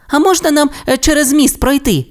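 very high, polite little voice; little Timmi asking. A narrator's reading. Timmi asks papa Rex if they may cross, very high. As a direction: ask permission nicely. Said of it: "спитав Тімі".

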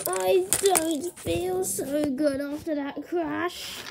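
soft, slow, low groan of a line; pretend groggy. Toy pilot after a helicopter crash, groggy. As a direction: drag it out weakly.

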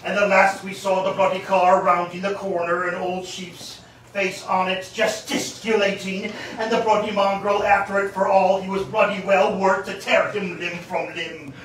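ordinary delivery, nothing marked

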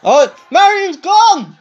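high, drawn-out match-call shout; excited shout. Real, not acted. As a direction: call out the knockout, loud and slow. Reacting to elimination in game.

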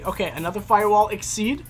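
ordinary talk, nothing marked

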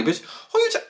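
squealed high mock challenge; high-pitched sassy mock threat; mock confrontation. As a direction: fling it back at her, high and sassy. Mocking a fighter's comeback.

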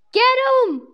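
high, loud command; urgent shout. Real, not acted. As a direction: shout the order. Urging teammate.

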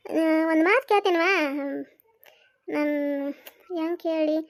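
normal talking voice

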